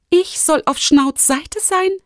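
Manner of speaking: high, slow incredulous question; indignant protest. Acted. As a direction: protest high, drawing out the disbelief. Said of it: "protestierte Lumi sofort". (empört und ungläubig, mit hoher Stimme)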